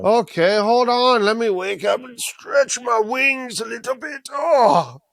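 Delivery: high, stretching, hammed-up dragon voice; waking dragon. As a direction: groan through a big wake-up stretch in mock dragon voice. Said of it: "I just woke up from a nap".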